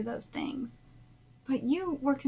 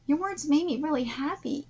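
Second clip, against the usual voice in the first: ordinary delivery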